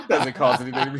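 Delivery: can barely get it out laughing; laughing hard. Real, not acted.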